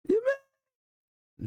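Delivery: very high disbelieving squeak; you can't be serious; incredulous. Real, not acted. Incredulous reaction.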